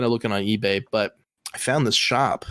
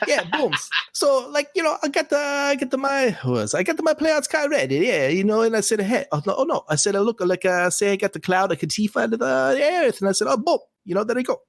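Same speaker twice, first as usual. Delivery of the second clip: much higher, hammed-up London Roadman accent; 13 semitones higher than usual. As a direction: put on a cartoonish Roadman accent, pitched way up. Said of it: "So that is the Roadman accent".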